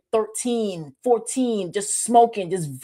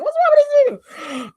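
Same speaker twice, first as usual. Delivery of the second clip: 1 mock shocked stare; 6 dB louder than usual. People staring at masked couple.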